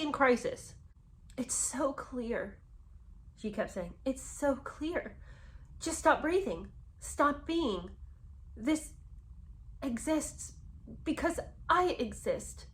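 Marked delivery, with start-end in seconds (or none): 1.4-2.6 soft, slow, mock-tragic reading; hammed-up soap heroine, drawn out; soap opera dialogue. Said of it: "what in the world is this soap opera dialogue". 4.0-12.7 higher-pitched, halting, mock-tragic reading; hammed-up soap heroine, every phrase set apart; mock the melodrama with weighty pauses; soap opera dialogue. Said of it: "what in the world is this soap opera dialogue".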